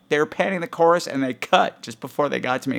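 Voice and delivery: ordinary talk, nothing marked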